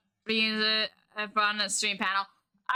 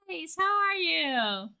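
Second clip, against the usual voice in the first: much higher, squealing greeting, slow on how are you; excited squeal; 7 semitones higher, slower than usual. Real, not acted. Streamer greeting chat with a squealed hello.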